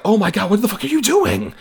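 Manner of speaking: high, alarmed pleading; horrified protest at a killing; upset, shocked. Retold or imitated. Madeleine Stowe horrified as Willis kills a man. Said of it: "she is upset".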